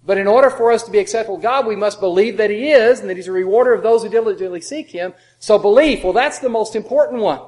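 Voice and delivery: loud, fast fervent preaching. Acted. Preacher mimics the belief-first view, loud and fast. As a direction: drive the point home hard.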